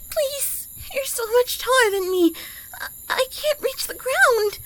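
high, faltering, frightened plea; scared pleading. Acted. Frightened woman begging someone taller, high and pleading. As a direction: plead in a high, stammering voice with gasps.

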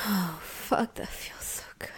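breathless afterglow whisper. Acted. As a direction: whisper it, spent.

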